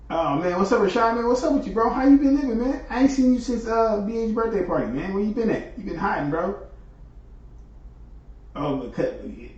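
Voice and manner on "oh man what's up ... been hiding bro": high-pitched, louder catching-up with a long-lost friend; excited greeting. Real, not acted. Streamer greets Rashad after a long absence, high excited greeting. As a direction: pitch it way up, hailing a missed buddy.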